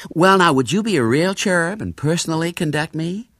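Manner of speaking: coaxing, high-pitched American lady; eager American lady tourist. A narrator's reading. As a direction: sweet-talk a boy into being the guide.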